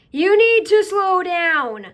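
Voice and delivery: slow, loud, emphatic instruction; slowed right down. Shown as a demonstration. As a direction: stretch each word out and press it home loudly. Stresses advice to slow down.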